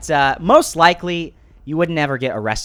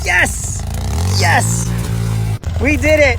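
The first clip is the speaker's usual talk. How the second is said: real triumphant cheer; 8 semitones lower, 3 dB louder, much slower than usual. Real, not acted. Celebrating the four-wheeler pulled out, loud cheering. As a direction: celebrate getting it out. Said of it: "I was very freaking excited".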